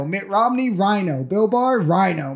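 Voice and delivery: usual speaking voice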